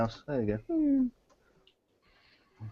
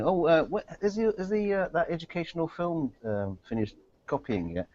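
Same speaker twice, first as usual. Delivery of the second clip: higher, hesitant innocent asker; asking colleague; 9 semitones higher, 3 dB quieter than usual. Retold or imitated. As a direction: play a sheepish co-worker asking. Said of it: "someone is asking".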